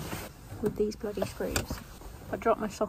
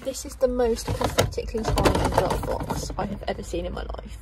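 ordinary delivery

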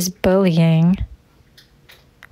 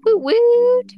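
woot cheer; 3 dB louder than usual. Real, not acted.

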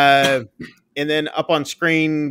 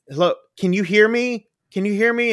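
higher, urgent operator voice; operator calling out; 8 semitones higher than usual. Retold or imitated. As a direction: call into the phone, worried. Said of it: "She's like".

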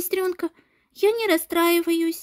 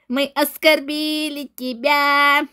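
mocking mimicry; 5 semitones lower, slower than usual. Bully mocks girl's complaint.

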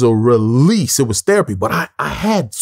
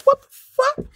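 high, squeezed incredulous reaction; baffled, pitched way up; stunned disbelief; slower than usual. Retold or imitated. Retells his stunned reaction to director.

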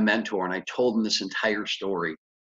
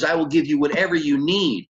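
higher-pitched imitation of an excited lender; 6 semitones higher, 4 dB louder than usual. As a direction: act out his thrilled reaction. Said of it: "tell how excited he was".

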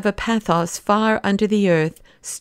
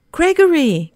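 high, startled cry of a name; astonished gasp; 9 semitones higher, 4 dB louder than usual. A narrator's reading. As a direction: call out the name in shocked recognition. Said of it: "gasped Syme".

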